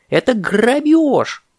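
high, slow indignant cry; parrot's outcry. Acted. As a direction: cry out ГРАБЁЖ, stretching it. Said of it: "закричал попугай".

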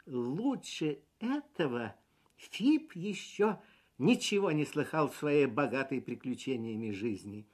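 ordinary delivery

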